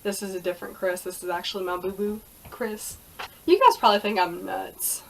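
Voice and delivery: ordinary delivery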